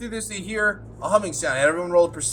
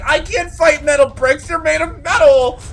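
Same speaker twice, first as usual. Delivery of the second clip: whiny protest; 6 semitones higher, 8 dB louder than usual. Retold or imitated. Voicing the player's complaint.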